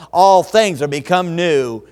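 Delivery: loud, ringing recitation building to a big Behold; recited memory verse. Shown as a demonstration. Preacher reciting the memory verse loudly to his congregation. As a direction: proclaim the verse from memory with rising force. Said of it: "Add just before and after".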